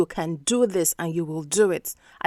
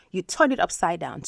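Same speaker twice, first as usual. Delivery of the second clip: usual speaking voice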